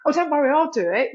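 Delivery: chirpy "no problem" voice, brushing it off; breezy people-pleasing. Retold or imitated. People pleaser offering to help.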